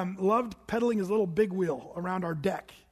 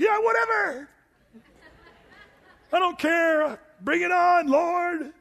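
high, loud mocking brush-off; cocky sneer thrown upward at God; defiant shrug-off; 10 semitones higher, 7 dB louder, slower than usual. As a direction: play the stubborn believer daring God. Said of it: "Some of you are like that".

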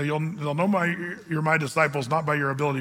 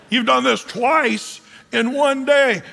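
slow, louder, fed-up accusation; exasperated scolding; 6 semitones higher, 5 dB louder, slower than usual. Pretend. Homeowner scolding the pastor for muddying twice, slow and loud. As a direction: lay the charge down slowly and hard.

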